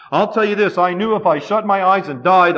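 ordinary talk

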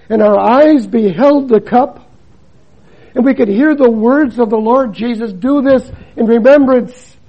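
full-voiced sermon cadence; forceful preaching. Preaches the Lord's Supper forcefully.

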